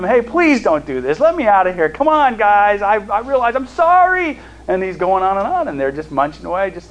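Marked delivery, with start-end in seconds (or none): 0.0-4.4 higher, louder mock plea from the pit; pleading boy. Joseph begs his brothers from the pit, a mild acted plea. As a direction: beg your brothers to let you out, lightly acted. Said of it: "he's pleading with them".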